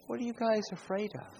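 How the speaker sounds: Jesus asking his disciples why they fear, soft and light; gentle question. Acted.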